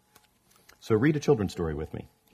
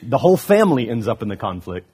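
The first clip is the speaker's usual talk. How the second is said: usual speaking voice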